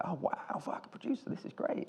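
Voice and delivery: whispered private thrill; excited whisper. Retold or imitated. Director retelling his inner excitement at meeting a producer, whispered. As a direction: hush it like a secret celebration.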